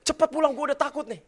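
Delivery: loud, high panicked plea; frightened wife. Retold or imitated. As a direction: beg him to come home now. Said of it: "saya bilang". (istri ketakutan meminta suami cepat pulang)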